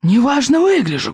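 put-on high, squealing old librarian; shrill squeal. A narrator's reading. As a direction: squeal it out, offended. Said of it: "взвизгнул". (взвизгнув, высоко и обиженно)

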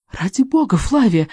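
mock-earnest narrated shout. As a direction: cry out at her to stop. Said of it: "закричала Порслин".

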